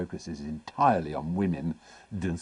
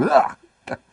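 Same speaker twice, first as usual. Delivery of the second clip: disgusted ugh; 6 dB louder than usual. Retold or imitated. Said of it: "Everyone else would go".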